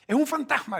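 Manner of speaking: high, loud frightened cry; scared cry. Acted. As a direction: cry out in terror at a ghost. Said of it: "gritaron de miedo".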